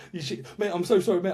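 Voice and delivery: ordinary delivery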